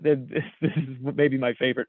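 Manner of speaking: ordinary talk, nothing marked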